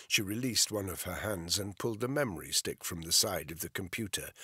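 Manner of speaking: ordinary delivery, nothing marked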